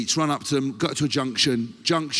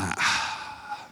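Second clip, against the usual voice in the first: tiny breathy ah; 8 semitones lower, 6 dB quieter than usual. Retold or imitated. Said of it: "I was like".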